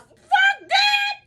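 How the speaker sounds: high-pitched cry of refusal; outraged shout. Real, not acted. Mother rejecting the five thousand dollars, outraged.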